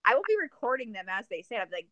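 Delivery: normal talking voice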